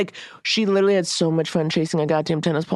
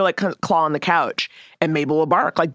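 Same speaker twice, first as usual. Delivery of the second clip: usual speaking voice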